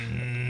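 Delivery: strained groan held back; restrained groan. Real, not acted. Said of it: "this is the self-restraint groan".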